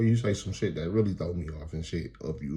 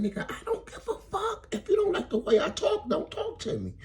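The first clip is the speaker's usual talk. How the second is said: high-pitched mock woman's retort; imitating angry woman. Woman brushing off the man texting her, mock-angry high voice. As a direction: do her dismissive comeback way up high.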